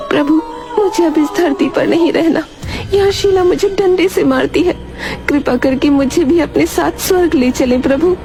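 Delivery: high-pitched pleading as a cow. Acted. Cow begs God to take her to heaven, pleading. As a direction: beg the lord in a high character voice.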